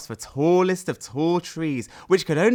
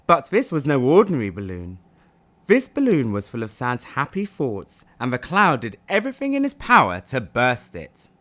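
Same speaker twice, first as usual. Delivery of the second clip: plain reading voice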